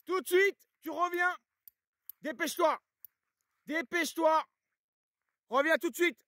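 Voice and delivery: high, loud shouted recall; yelling at the dog. Real, not acted. Owner yelling at the dog chasing a deer, loud and high. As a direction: yell him back hard, pitch way up. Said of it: "j'ai dû gueuler un peu".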